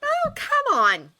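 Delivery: high, drawn-out exasperated growl. A narrator's reading. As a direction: stretch it out loudly in frustration. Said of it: "Astrid growled".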